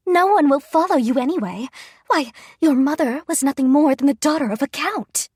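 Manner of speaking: high, loud, furious queen; queen lashing out at the rightful heir; screaming in rage. A narrator's reading. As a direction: spit the insult out with hatred. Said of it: "Queen Elia screamed".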